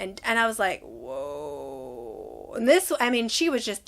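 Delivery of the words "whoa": very low, soft, drawn-out whoa; stunned whoa. Retold or imitated. Mother being screamed at by the aide, stunned reaction. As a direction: let it sink low and long, taken aback.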